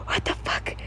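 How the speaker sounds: breathy unvoiced whisper; whispered thought. A narrator's reading. Reader whispering the narrator's shocked inner thought. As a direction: hiss it under your breath. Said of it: "You thought".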